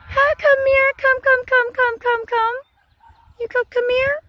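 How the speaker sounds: high repeated come-here call; coaxing animals. Real, not acted. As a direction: coax the cattle closer in a high voice.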